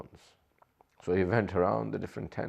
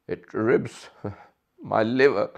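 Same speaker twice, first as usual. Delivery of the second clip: slow, loud words broken by a sob; voice breaking; 6 dB louder, slower than usual. Real, not acted. Preacher voicing Imam Hussain's heartbreak over children, sobbing. Weeping amid the lament.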